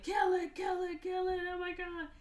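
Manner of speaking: high-pitched, loud mock frantic cries; retold panic. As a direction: imitate someone freaking out. Panicked man orders creature killed.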